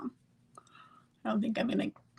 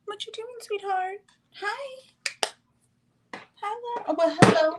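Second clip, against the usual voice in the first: very high, sing-song cooing at a pet; 11 semitones higher than usual. Real, not acted. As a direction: coo up high like talking to a puppy. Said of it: "talk like that to me".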